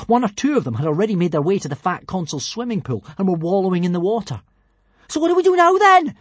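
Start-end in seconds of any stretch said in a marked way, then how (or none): 5.1-6.1 high, loud shout. Acted. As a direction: shout over the wind. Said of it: "shouted Kamikaze, her teeth chattering".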